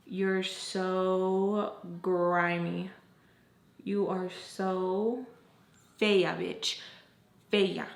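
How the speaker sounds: slow, drawn-out contempt; silent disgust voiced. Her face speaking to the friend, slow contempt. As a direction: drag each word out in disgust. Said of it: "And my face is just".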